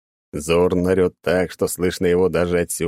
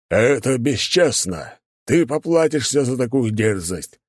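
growling; 5 semitones higher, slower than usual. Acted. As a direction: rage at the dishonour. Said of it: "зарычал воин".

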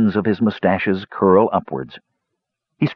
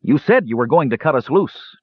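understated angry snarl, pitch raised; a touch of angry accusation; 6 semitones higher than usual. A narrator's reading. Bound captive demanding to be cut loose, mildly snarling. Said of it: "Tannen snarled".

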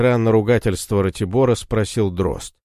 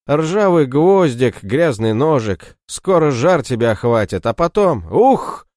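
gloating scare; 6 semitones higher, 4 dB louder than usual. Acted. Кречет пугает раненого брата лихоманкой, злорадно. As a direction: savour every threat to frighten him.

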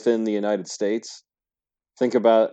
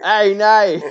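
high, loud, hammed-up Aussie; Australian accent; 11 semitones higher, 10 dB louder than usual. Shown as a demonstration. Clowning with the test word, exaggerated Australian accent. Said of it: "for the Australian accent is the word no".

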